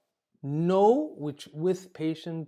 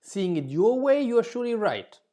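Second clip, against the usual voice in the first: higher and louder, eagerly agreeing; agreeable concession; 7 semitones higher, 6 dB louder than usual. Shown as a demonstration. Teacher demonstrating a positive phrase for arguing clients, higher and louder. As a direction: grant them the point with bright enthusiasm. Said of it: "Positive expressions are like".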